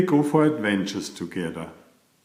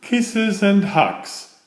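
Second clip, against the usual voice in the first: higher, slow, sing-song explanation; Beaver explaining; 10 semitones higher, 3 dB louder, much slower than usual. Acted. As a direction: spell it out sweetly like a small child.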